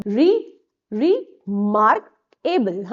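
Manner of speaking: "remarkable" very slowly split, re-mark-able; syllable by syllable. Shown as a demonstration. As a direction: break "remarkable" into slow separate syllables. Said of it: "तो three syllable वाला word है". ("remarkable" को धीरे-धीरे अक्षर तोड़कर बोलें)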